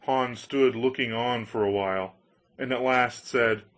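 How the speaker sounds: ordinary delivery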